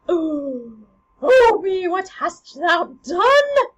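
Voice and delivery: loud, high, drawn-out horrified cry; horrified queen. Acted. Queen to Hamlet after the stabbing, horrified wail. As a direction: wail it slowly in theatrical horror. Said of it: "She's horrified".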